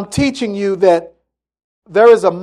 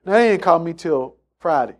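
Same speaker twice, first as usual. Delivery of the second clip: ordinary talk, nothing marked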